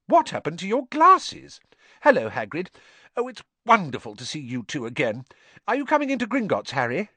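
put-on high, eager girl; Hermione, eager. A narrator's reading. Hermione greets Harry and Hagrid, eager and high. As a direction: bubble over with questions, pitched high.

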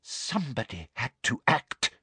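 soft, hushed admission; quietly self-righteous villain; breathed. A narrator's reading. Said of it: "breathed Umbridge".

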